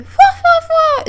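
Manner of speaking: very high, loud imitated laugh; high mock fwa laugh; Killer's laugh. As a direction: squeak out a strange character laugh. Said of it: "Is that how he laughs again?".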